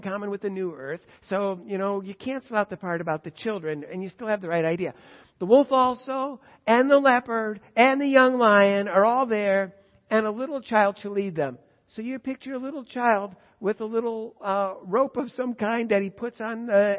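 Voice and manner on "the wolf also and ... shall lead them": loud, slow and high, each creature drawn out; proclaimed scripture. Acted. Preacher reading Isaiah's peaceable animals aloud, slow and loud. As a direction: lift the verse up slowly to the room.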